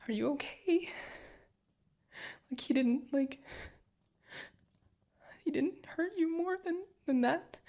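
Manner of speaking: frightened, voice cracking upward; panicked concern for partner; alarmed. Acted. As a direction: pitch leaps high in panic. Hears partner was knifed.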